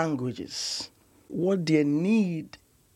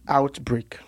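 ordinary talk, nothing marked

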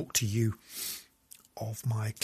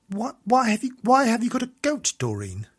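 higher, stammering incredulous question; baffled disbelief; 8 semitones higher, 4 dB louder than usual. Acted. Host finds his guest has brought a goat, baffled disbelief. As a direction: stumble over the question in alarm.

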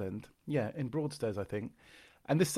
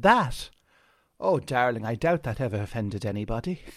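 mum's dry put-down; 2 semitones lower, 6 dB louder than usual. Retold or imitated. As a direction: deliver the Irish mammy's withering put-down. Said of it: "looked at his penis and said".

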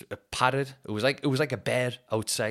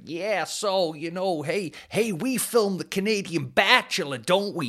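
much higher, hammed-up cheery American twang; mocking the crew's chatter; mock North American crew; 10 semitones higher, slower than usual. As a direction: parody of chirpy TV crew bros. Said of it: "they were just chatting, like".

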